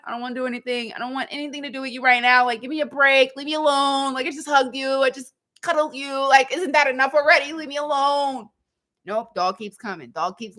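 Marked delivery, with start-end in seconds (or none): 0.0-8.5 higher, fast, fed-up pleading; exasperated brush-off. Retold or imitated. Owner begging the clingy dog to leave her alone, exasperated.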